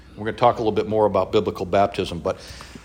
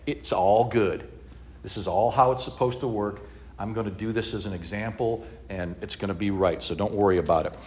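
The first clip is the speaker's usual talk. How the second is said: reassuring Jesus; 4 semitones lower, 6 dB quieter than usual. Retold or imitated. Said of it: "Jesus is like".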